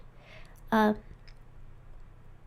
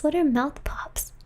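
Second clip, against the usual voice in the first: whispered; 4 semitones higher than usual. Shown as a demonstration.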